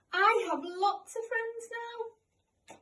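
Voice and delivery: high, loud, very slow call from far off; distant echo. A narrator's reading. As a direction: ring back across the pond like an echo. Echo answers from across pond.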